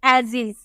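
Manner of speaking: "as is" with buzzing Z sounds for each S; teacher modelling "as is" with voiced S. Shown as a demonstration. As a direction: say "as is" with Z, not S. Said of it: "इसे हम जॉ में लिखे हैं".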